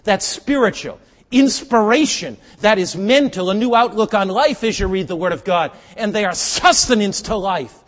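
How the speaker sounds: high, booming preacher's shout; full-force preaching. Real, not acted. Preacher extolling the Word of God to a congregation, full force. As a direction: preach it loud and high to the back rows.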